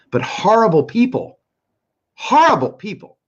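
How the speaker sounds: high, loud outburst on "horrible"; angry contempt. Real, not acted. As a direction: spit out real disgust at them. Angry rant at opponents.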